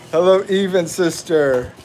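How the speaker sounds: ordinary delivery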